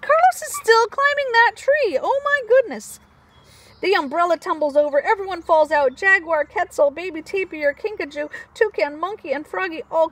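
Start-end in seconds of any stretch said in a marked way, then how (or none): none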